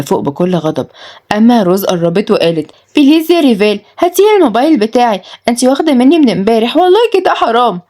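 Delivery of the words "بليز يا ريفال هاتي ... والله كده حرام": high, whiny begging; pitched-up wheedling complaint; pleading girl. Acted. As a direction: plead like a teen denied something. Girl begs for her phone.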